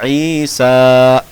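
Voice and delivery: ईसा chanted with both vowels held long; very slow ईसा on a level tone; elongated recitation. Shown as a demonstration. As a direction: stretch सा of ईसा, loud and steady. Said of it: "ऐसे लिखा हुआ है".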